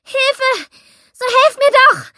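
high, loud desperate scream; screaming for help. Acted. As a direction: scream it into the dark. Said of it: "Ich schrie".

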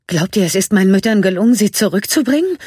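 fast, high, loud worried question; alarmed. A narrator's reading. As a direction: blurt it out in alarm. (hastig und erschrocken)

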